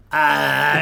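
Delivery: loud half yell, half retch; imitated guttural scream. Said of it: "this guttural, like, half yell".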